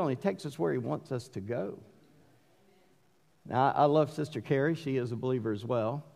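normal talking voice

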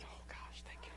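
very soft whispered aside; relieved whisper. Retold or imitated. Pastor recognised after keeping his temper, whispering in relief. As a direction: whisper it to yourself in mock relief.

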